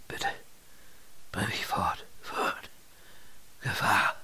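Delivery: weak, whispered plea of the wounded; exhausted, fading. A narrator's reading. Wounded man begs Lynott to take him away, fading. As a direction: whisper brokenly, strength draining away. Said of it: "des wieder ermattenden Mannes".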